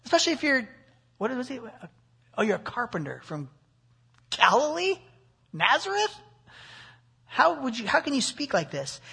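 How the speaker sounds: high mock disbelief, as an offended elder; incredulous scoff. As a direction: scoff at a carpenter speaking like this. Voicing Nicodemus' indignation at Jesus.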